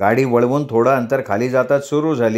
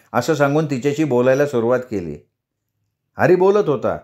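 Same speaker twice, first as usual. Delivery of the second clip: usual speaking voice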